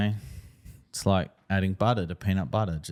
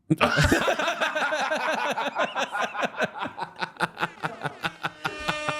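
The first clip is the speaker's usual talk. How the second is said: long, high-pitched helpless laughter; long laughing fit. Real, not acted. Host reacting to dark joke, long high laughing fit. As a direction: keep laughing till it trails away.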